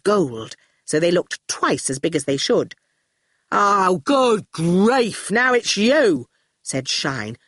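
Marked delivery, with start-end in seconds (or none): 3.5-6.3 slow, drawn-out weary groan of words; exasperated. Acted. Shine sees Torquil march in, exasperated. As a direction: greet an unwelcome visitor with exasperation.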